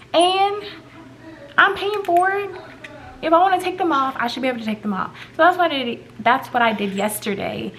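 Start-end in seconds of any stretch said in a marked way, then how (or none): 0.1-4.2 high, indignant protest. Real, not acted. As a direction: insist it's your own money. Indignant rant about braces.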